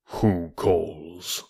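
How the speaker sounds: full-fed tiger, sleepy and menacing; drawling sleepy snarl. Acted. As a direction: drawl it like a tiger just woken. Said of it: "the drawling, sleepy snarl of a full-fed tiger".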